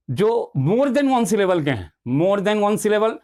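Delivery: slow teacherly emphasis; emphatic. Shown as a demonstration. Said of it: "stresses the key phrase".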